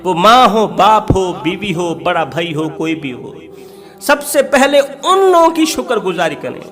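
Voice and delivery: ordinary talk, nothing marked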